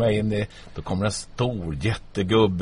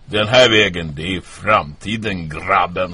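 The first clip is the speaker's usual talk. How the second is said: louder, proud usher's call, slower in the middle; hearty doorman call; 8 dB louder than usual. Retold or imitated. Doorman ushering young job-seeker inside, louder hearty call. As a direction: boom it out like a grand doorman. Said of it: "gör han honör igen och säger".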